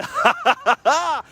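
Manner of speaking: a high, gleeful burst of laughter; giddy victory laugh. Real, not acted. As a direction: laugh in delight at the win. Gleeful laugh after boast.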